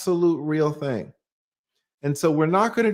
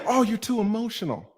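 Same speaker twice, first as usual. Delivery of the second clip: a softer, mocking put-down; mock scolding; 4 semitones higher, 4 dB quieter than usual. Mocking women who call her too emotional, sneering. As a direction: sneer it back.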